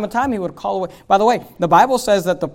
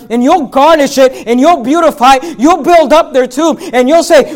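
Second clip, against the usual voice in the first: loud, slow mocking pulpit rebuke; loud preaching; 4 semitones higher, 7 dB louder than usual. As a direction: build the charge line by line.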